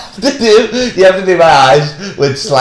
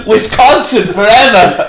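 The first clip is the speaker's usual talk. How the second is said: drawn-out, higher slogan salute; mock battle cry; 6 semitones higher, 4 dB louder than usual. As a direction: proclaim it slowly like a grand slogan. Mock slogan for widest place.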